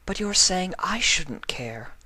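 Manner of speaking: hurt whisper. Acted. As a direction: whisper it, wounded. Hurt Octavia answers.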